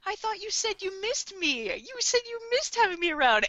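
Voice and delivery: high, put-on wounded voice; mock-hurt teasing. L reminds Light he missed him, mock-hurt. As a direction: raise the pitch and play hurt.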